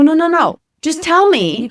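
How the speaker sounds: high and loud, urgently cutting him off; insistent no no no. Retold or imitated. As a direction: push back hard, frustrated and eager. Insisting on phone to front desk.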